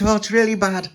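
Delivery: high, loud worried voice; alarmed character. A narrator's reading.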